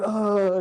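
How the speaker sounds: high, drawn-out imitated groan; mock dying groan. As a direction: groan as if falling down dead. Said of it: "like, really dying".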